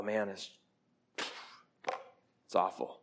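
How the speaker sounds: hushed lament; whispered grief. Acted. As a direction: let it fall to a hush. Grieving the ravages of sin.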